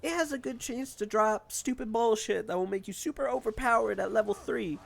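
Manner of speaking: put-on high, loud mechanic-girl voice; Ellie impression. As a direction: pitch way up and hype it like Ellie. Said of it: "where Ellie's like".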